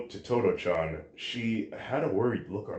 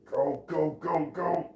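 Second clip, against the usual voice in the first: high, repeated urging without extra volume; straining upward through each word; strained self-urging; 8 semitones higher than usual. A narrator's reading. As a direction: push yourself on, pitch climbing. Hero straining to finish healing.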